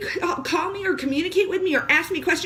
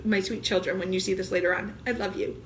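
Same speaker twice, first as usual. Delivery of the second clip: tender; 7 semitones lower, 6 dB quieter than usual. Real, not acted. Addressing her children tenderly.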